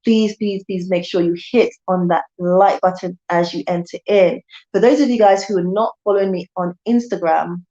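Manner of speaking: usual speaking voice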